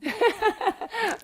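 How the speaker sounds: high, loud delighted laugh; a sudden bright laugh of delight; burst of laughter. Real, not acted. Host laughing loudly at the guest's answer about her husband.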